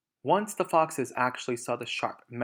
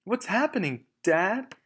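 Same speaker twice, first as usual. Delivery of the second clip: high, anxious little fox voice; frightened cry; 8 semitones higher, 5 dB louder than usual. Acted. Small foxes crying out to their father in fear. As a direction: ask Dad in alarm. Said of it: "cried the small foxes".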